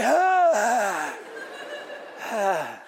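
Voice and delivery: breathless laughing shout trailing to a laugh; winded laughing cry; laughing groan. Retold or imitated. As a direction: gasp out a spent, laughing ah. Mock exhausted reaction to daughter.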